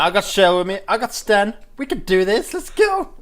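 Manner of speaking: loud, high pumped-up rallying; hyped rally cry. Pretend. Player rallying companions to storm the gates, loud pumped-up cry. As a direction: fire up the squad. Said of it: "I'm like".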